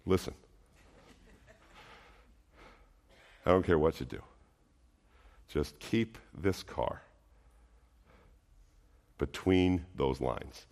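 put-on a parent's quiet, grave word to a new teen driver; low plea to son. Parent in the passenger seat to teenage son, low and hushed. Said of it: "what do you say to your son".

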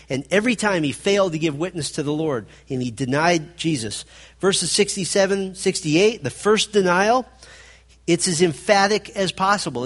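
usual speaking voice

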